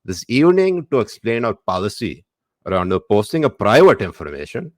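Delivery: put-on reading in a South Indian accent.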